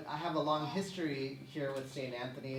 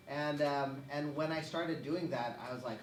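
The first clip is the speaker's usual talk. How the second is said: usual speaking voice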